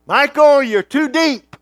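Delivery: high, loud alarmed yell from the beach; grandmother screaming. Retold or imitated. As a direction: call out like a panicked grandmother. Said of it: "screaming at me".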